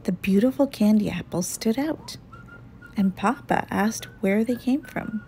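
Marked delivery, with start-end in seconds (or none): none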